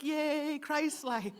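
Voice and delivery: high, bright playful yay; congregation's mock excitement at the new moon, high and cheery; mock cheer. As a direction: cheer like a delighted kid. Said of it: "And we're like".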